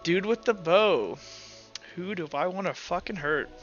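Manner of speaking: ordinary delivery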